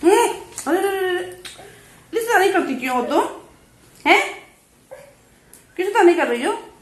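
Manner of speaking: high mock-stern scolding. Real, not acted. Mother telling off her naughty baby in high baby talk.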